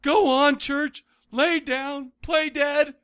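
high mock dad calling the cat. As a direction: parody the wooden actor, high and slow. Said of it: "I cringe at".

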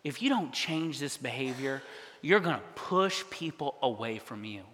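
soft, low voice imitating a mother; humble correction. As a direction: gently admonish a son, hushed and low. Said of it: "she did say it in a humble way".

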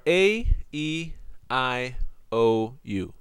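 "A, E, I, O, U" as separate English letter names, "A" strong and loud; pronouncing vowel names. Shown as a demonstration. Teacher lists the vowels for students, distinct letter-name delivery. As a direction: announce "A, E, I, O, U" with spaces between. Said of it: "And the vowels are".

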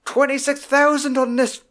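high, disbelieving mock outrage; mock rant. Film dad ranting about expenses, mock outrage. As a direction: stretch the bill out in high indignation. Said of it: "it's his fucking rant".

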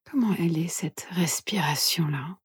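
soft, hushed meditation guide; guided meditation whisper. Acted. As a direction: whisper gently, leaving long pauses.

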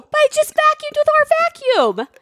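high, fast insistent protest; high-pitched rushed protest. Real, not acted. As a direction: push back urgently. Heated defense mid-argument.

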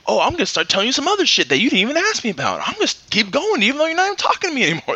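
fast, high, rambling mock heckler; mocking chatty heckler. Comic mocks a talkative audience member in a fast, high, rambling voice. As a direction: gush on and on like a tipsy heckler.

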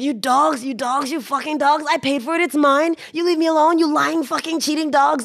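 hammed-up furious drunk snarling at shopkeepers; retold drunk rant. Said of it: "he's, he's".

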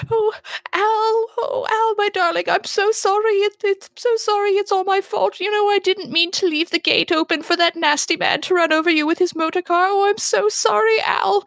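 very high, wailing apology, hammed up; distraught Penelope. Owner apologizing to her dead dog, high and overwrought. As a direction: pour out guilt in a high-pitched lament. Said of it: "And Penelope goes".

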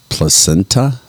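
"Placenta" said pluh-SEN-tuh, rising; questioning pronunciation. Shown as a demonstration. Host sounding out an odd middle name, "Placenta" as pluh-SEN-tuh. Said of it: "Her middle name was".

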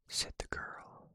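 plain reading voice

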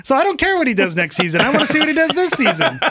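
high-pitched, animated objection; worked-up protest. Real, not acted. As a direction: argue excitedly, pitch riding high. Host protests at cautious co-hosts.

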